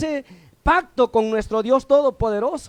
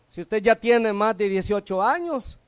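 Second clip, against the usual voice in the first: ordinary delivery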